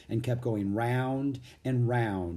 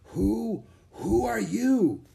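high, halting hoarse question; weary knight's hoarse voice; 10 semitones higher, 3 dB louder, much slower than usual. A narrator's reading. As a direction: ask hoarsely, stumbling on the first word. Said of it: "he asked in a hoarse voice".

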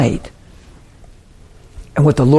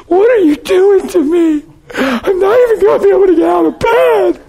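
high-pitched, squealing mock sob; hammed-up pitiful crying voice. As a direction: wail helplessly, imitating a tearful plea. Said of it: "crying in a pitiful voice".

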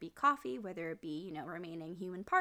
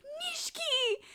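calling out; slower than usual. Retold or imitated. Girlfriend flings door open.

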